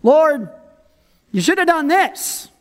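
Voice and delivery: high, presumptuous correction; mock advising. We counsel God on what to do, mock advising. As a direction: lecture God like a know-it-all. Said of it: "giving him advice on what to do".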